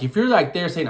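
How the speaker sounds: ordinary talk, nothing marked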